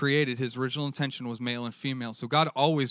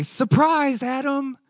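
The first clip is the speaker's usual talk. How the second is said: mock surprise reveal; 11 semitones higher, 6 dB louder, much slower than usual. God revealing woman to Adam.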